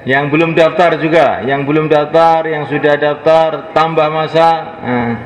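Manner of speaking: loud, high rallying call to sign up; preaching at full force. Real, not acted. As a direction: rouse the crowd to stand and register. Ajakan berdiri dengan suara lantang. (seruan dakwah lantang dan tinggi)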